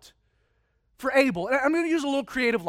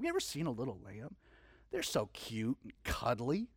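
hushed and tender, far softer; tender aside; 12 semitones lower, 14 dB quieter than usual. Real, not acted. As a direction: coo it softly like over a lamb. Tender aside about a lamb.

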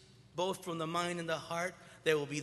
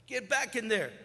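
a hint of a coach calling loudly; 7 semitones higher, 6 dB louder than usual. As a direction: call him back onto the field. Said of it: "The coach goes up to him".

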